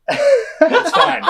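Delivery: high, laughing reassurance; Dan Harmon laughing it off. Retold or imitated. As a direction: chuckle it off with a beer in hand. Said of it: "He's like".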